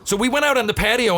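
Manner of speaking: storyteller hitting the climax, voice soaring; excited retelling. Real, not acted.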